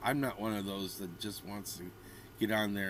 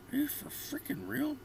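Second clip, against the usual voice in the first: incredulous; 3 dB quieter than usual. Real, not acted. Disbelief at comment.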